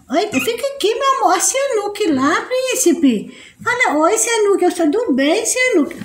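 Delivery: high soft cooing voice for a little one. Real, not acted. Mother coos to her little son, soft high baby talk.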